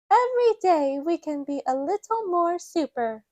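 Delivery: put-on high cheerful child voice; high child voice cheering others on; Peppa. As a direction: encourage friends like a little girl. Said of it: "Peppa says".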